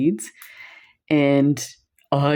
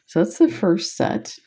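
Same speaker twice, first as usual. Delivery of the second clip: ordinary talk, nothing marked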